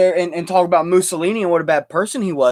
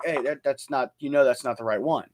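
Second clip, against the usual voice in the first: softer, low, easygoing correction; gentle correction; 5 semitones lower, 8 dB quieter than usual. Retold or imitated. Correcting a misquoting friend, soft and low. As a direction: set them straight kindly and quietly. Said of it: "I'm like".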